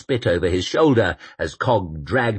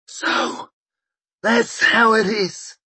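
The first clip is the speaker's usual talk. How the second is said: put-on stunned old man; slower than usual. A narrator's reading. Koska stunned.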